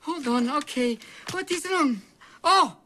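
put-on very high, panicked Russian accent; frantic meltdown. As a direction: wail at the machine in a panic. Doctor panicking over faulty computer.

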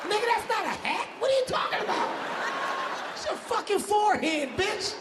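high-pitched disbelieving rant; incredulous rant. Real, not acted. Comic yelling at his friend, high incredulous rant. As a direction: go up high, can't believe him. Ranting at friend about forehead.

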